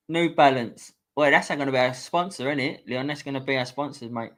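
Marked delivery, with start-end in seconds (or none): none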